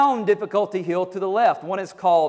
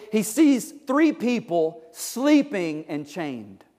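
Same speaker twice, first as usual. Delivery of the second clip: ordinary delivery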